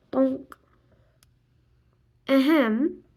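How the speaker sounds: plain reading voice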